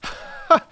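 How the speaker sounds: a burst of hearty laughter. Acted. Holmes laughs at being outwitted, a hearty burst. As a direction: laugh out at your own failure. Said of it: "burst into a hearty laugh".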